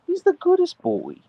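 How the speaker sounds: high, sing-song pet voice praise. Real, not acted. Praising her husky Archer in high baby talk.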